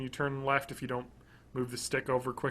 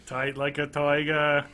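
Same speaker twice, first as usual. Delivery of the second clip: slow Boston drawl, toiger; a bad JFK Boston accent; slower than usual. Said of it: "Boston accent for me is doing a bad JFK impersonation".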